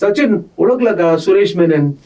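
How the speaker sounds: Amol Gupta. Retold or imitated. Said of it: "he takes me and says".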